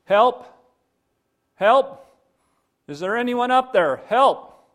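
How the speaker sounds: high, loud calls for help; desperate high-pitched holler; shouting for help. Acted. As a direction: shout up to anyone who might hear. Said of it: "shouting out".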